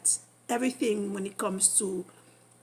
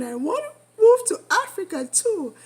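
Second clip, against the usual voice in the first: higher, louder burst of excitement; giddy, louder exclamation; excited; 8 semitones higher, 7 dB louder than usual. Real, not acted. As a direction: blurt it out, too excited to hold back. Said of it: "let me calm myself down".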